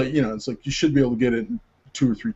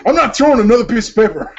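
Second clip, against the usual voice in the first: high, loud indignant protest; mock refusal; 9 semitones higher, 6 dB louder, faster than usual. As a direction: play an actor digging in, hammed up. Fed-up actor refuses, retold.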